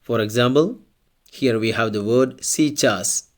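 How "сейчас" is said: "сейчас" said si-CHAS; unstressed E as I. Shown as a demonstration. Teacher models an example word for learners, "сейчас" as si-CHAS. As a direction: say "сейчас" with ee first, stress on the end. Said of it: "it's pronounced like E".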